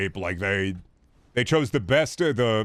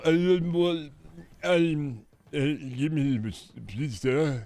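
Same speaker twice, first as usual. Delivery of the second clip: much higher, put-on Trump voice with a gasp; comedy Trump impression; 7 semitones higher, slower than usual. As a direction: ham up a Trump rant. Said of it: "I was doing a comedy Trump impression".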